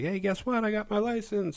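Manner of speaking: high-pitched imitation of a girlfriend with news; excited girlfriend.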